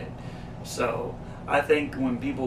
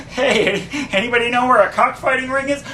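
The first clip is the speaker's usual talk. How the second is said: higher, louder, fast and laughing; mock Kramer asking around; 9 semitones higher, 7 dB louder, faster than usual. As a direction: rattle it off giggling. Same bit repeated.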